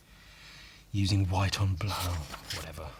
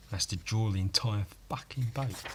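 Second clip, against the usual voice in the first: ordinary delivery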